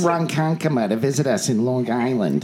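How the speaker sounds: put-on Long Island accent.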